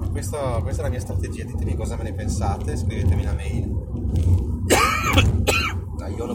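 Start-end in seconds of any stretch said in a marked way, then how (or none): 3.7-5.9 chesty cough. Real, not acted. Vlogger coughing mid-sentence. (tosse di petto)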